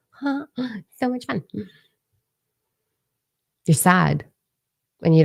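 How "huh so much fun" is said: sarcastic gasp. Acted. Mocking her own overgiving.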